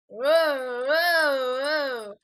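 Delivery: loud, drawn-out rising and falling whoas; alarmed whoas. Real, not acted. Player reacting to the game, loud alarmed cry.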